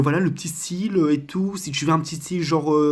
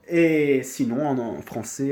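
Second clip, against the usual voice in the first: ordinary talk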